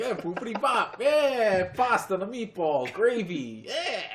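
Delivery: very loud, high-pitched cartoon Italian; mock Italian accent. Teasing a friend whose accents turn Italian, in loud mock Italian. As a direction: shout it as a hammed-up Italian caricature. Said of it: "like, Italian".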